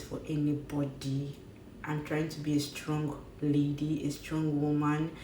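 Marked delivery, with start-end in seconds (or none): none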